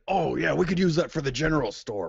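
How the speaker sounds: voiced as a keen character; eager character. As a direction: pipe up eagerly with an idea. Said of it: "He's like".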